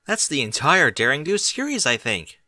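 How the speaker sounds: plain reading voice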